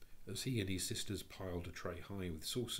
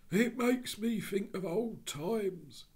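slow, fond Cockney musing; a soothed burglar reminiscing; dreamy burglar; 14 semitones higher, 8 dB louder, slower than usual. Acted. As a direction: remember old days contentedly.

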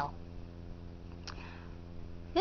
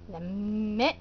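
ordinary delivery, nothing marked

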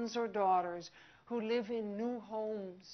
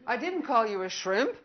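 defensive protest; 9 dB louder than usual. Acted. Acted misheard customer exchange.

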